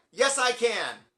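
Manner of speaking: high, loud called-out reply; overconfident shout. Retold or imitated. Fan assures singer he can play, loud bold call. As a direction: sing out confidence to the stage.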